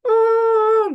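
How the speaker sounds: high, loud mock wail; acted little sibling's protest howl; long high cry of mock anger; angry sibling's cry. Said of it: "gitu kan ya, dia marah".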